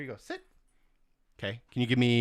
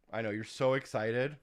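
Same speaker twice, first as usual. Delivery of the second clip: ordinary talk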